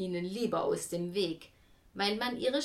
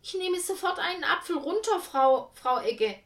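fast, high, eager nervous call; calling out loudly; 10 semitones higher, 6 dB louder than usual. A narrator's reading. The fruit seller hurries to appease Frau Egge, fast loud call. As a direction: rush to please and avoid trouble. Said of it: "rief laut".

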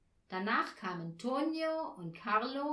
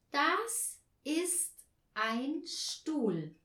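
slow, higher model sentence; slow repetition; 6 semitones higher, 3 dB louder, much slower than usual. A narrator's reading. Anja repeating the lesson for Herr Marconi, slow and higher. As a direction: repeat it word by word, pitched up. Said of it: "wiederholte Anja".

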